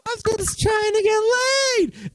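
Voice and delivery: whiny high-pitched plea. Retold or imitated. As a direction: whine it like a pleading boy. Whiny high self-quote.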